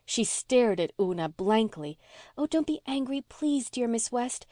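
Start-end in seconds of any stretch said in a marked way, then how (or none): 2.4-4.5 put-on high-pitched child's plea; high, imploring little girl; pleading. A narrator's reading. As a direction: beg sweetly in a higher voice. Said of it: "said Una pleadingly".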